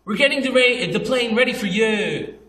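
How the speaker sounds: high, oddly lilting plane announcer; high-pitched quirky cabin announcement; awkward announcer imitation. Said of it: "he would say it like this".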